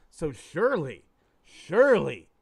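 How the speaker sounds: rising loud insistence on surely; hopeful insistence. Acted. Businessman convinces himself in joke.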